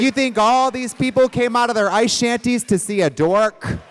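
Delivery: high, loud indignant rant; loud mock outrage. Host defending the crowd in their ice shanties, loud mock outrage. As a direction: holler as if affronted for the whole crowd.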